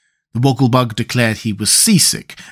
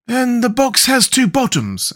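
high, slow, drawn-out Scarecrow voice; Scarecrow's announcement; 12 semitones higher than usual. A narrator's reading. Scarecrow tells his friends of the box's secret, announcing it. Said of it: "announced the Scarecrow".

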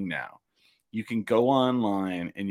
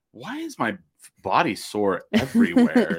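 mock aching complaint; 7 semitones higher, 3 dB louder than usual. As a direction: stretch out a pretend complaint, pitched high. Imagined morning-after complaint.

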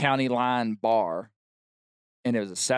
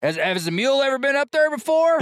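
barfly voice; 13 semitones higher than usual. Retold or imitated. Bar patrons chiming in.

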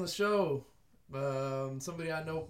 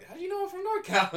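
surprised; 5 dB louder than usual. Retold or imitated. Surprised retold reply.

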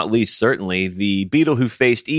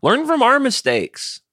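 mock sage advice. Mock wise-elder advice.

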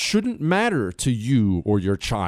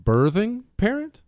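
incredulous; 10 semitones higher than usual. Real, not acted. Mocking a term just quoted.

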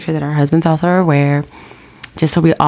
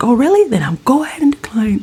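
huffy sarcastic husband; 7 semitones higher than usual. Retold or imitated. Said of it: "So he's like".

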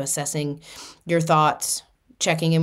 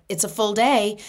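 ordinary talk

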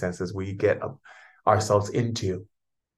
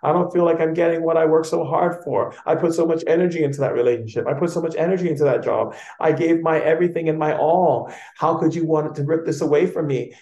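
much higher, louder panicked complaining; upset ego; 8 semitones higher, 6 dB louder than usual. Pretend. Frightened ego pleading with its owner, high and upset. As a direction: whine like a frightened ego losing everything. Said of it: "when the ego gets mad and goes".

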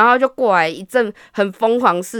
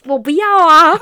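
high, loud comic protest; mock inner wail; 6 semitones higher, 7 dB louder than usual. To himself on the date, loud comic protest. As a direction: cry out inwardly in dismay. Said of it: "然后我心里面想说".

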